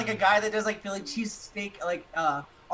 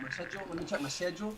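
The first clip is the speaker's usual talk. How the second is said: mock-British accent, softly said; imitated British accent, sh in schedule; 5 dB quieter, faster than usual. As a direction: put on a British accent, "shed-jool".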